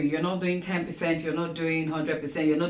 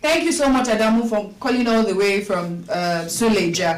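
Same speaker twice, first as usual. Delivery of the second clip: to someone hard of hearing; 2 semitones higher, 6 dB louder than usual. Real, not acted. Caller on a bad phone line.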